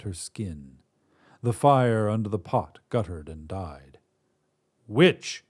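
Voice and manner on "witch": god booms out one loud word; booming call. Acted. God bursts in on the witch, booming. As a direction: boom it through the whole house. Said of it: "a voice boomed through her home".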